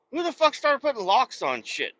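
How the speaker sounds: high puzzled mock outrage; lightly voiced confused drifter; mock bewildered homeless guy. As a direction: act baffled by locks. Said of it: "they're like".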